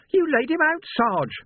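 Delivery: put-on astonished young lad squeaking; squeaked. A narrator's reading. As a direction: voice jumping up in shock. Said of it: "Sam squeaked".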